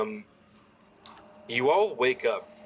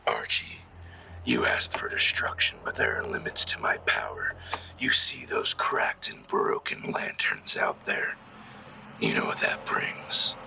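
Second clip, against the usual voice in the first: dark whisper behind you; 12 semitones lower, 4 dB quieter than usual. Acted. GM voices shadow entity.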